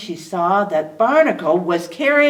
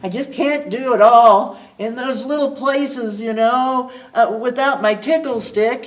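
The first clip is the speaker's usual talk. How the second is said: grumbling; 7 semitones higher, 7 dB louder than usual. A narrator's reading. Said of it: "he grumbled".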